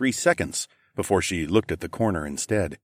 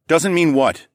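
higher, louder challenge; demanding; 9 semitones higher, 6 dB louder, slower than usual. A narrator's reading. Narrator cuts off the objecting man, demanding. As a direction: cut in and demand an answer. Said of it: "I demanded".

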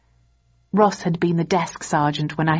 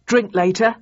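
cheerful; 4 semitones higher, 4 dB louder than usual. A narrator's reading. Ross invites her out.

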